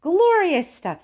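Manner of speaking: high, loud, slow relish; delighted. A narrator's reading. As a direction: savour the words. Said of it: "said Mister Wonka".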